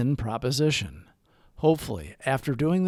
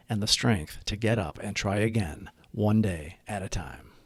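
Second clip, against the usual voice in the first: prayer; 5 semitones lower than usual. Acted. Recites the Serenity Prayer.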